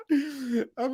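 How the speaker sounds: high crying laugh; laughing hard at Pep. Real, not acted. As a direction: laugh through the words.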